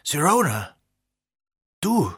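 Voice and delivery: hohe, freudig überraschte Ausrufe; freudiges Erstaunen. A narrator's reading. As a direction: die Stimme springt vor Freude hoch. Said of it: "Ausdruck freudigen Erstaunens". (freudig überrascht, steigend hoch)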